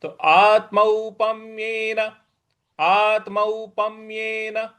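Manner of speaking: slow आत्मौपम्येन with stress on the half म before येन; Sanskrit recitation. Shown as a demonstration. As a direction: chant आत्मौपम्येन slowly, pressing पम्. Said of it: "इस प्रकार से बोलेंगे". (आत्मौपम्येन धीरे, आधे म पर आघात)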